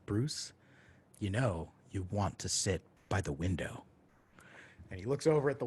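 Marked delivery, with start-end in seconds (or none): 0.0-3.8 low, collected voice, pausing after the name; unhurried, measured voice guiding him from within; calm inner voice. Retold or imitated. The voice returns as Bruce is about to eat, calm and collected. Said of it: "that voice came back".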